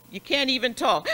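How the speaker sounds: acting out a man crying too hard to talk; mock sobbing cry. Shown as a demonstration. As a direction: show the big boo-hoo cry through gasps. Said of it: "This was the big boo-hoo cry".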